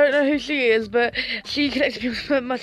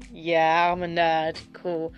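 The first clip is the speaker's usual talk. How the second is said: deadpan reply; 5 semitones lower, slower than usual. Retold or imitated. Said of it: "I'm just like".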